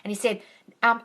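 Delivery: ordinary talk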